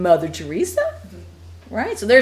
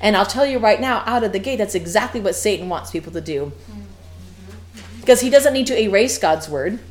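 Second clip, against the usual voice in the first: normal talking voice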